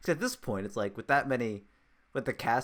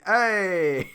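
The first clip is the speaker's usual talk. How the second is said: hammed-up Italian-American New Yorker call; higher, drawn-out mock New York Italian holler; 5 semitones higher, 4 dB louder than usual. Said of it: "like, the New York City".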